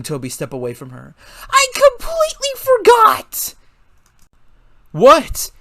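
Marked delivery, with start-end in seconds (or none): 1.5-3.5 high, slow, loud wail of dismay. A narrator's reading. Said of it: "Melissa shouted". 4.9-5.5 loud startled question; slight panic. A narrator's reading. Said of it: "asked in a slight panic".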